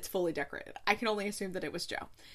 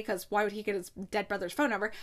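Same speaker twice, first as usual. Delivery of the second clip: normal talking voice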